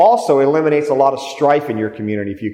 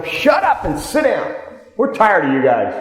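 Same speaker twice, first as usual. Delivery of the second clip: much higher, slow mock scolding; mock angry audience; 5 semitones higher than usual. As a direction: voice the crowd telling them off. Audience turns on the agitators.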